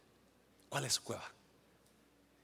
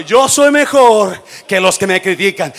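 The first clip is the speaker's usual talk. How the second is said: much louder, higher, full shout; shouted declaration; 6 semitones higher, 23 dB louder than usual. Shown as a demonstration. Pastor pushing church to shout affirmation, full shout. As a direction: shout it with total conviction. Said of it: "No, no, dígalo".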